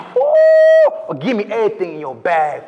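loud, high-pitched hammed-up hold-up with a whoop; hammed-up robber act. Lineup suspect taking another try at the dialogue, over-the-top loud robber. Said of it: "Let me give it another one".

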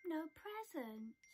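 slow, sad sigh. Acted. Mouse sighing over no presents. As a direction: let the hope go. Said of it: "sighed Mouse".

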